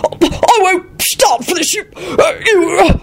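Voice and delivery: high, halting, drawn-out splutter; splutter, too enraged to find the words; spluttering rage. A narrator's reading. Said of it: "stood up spluttering".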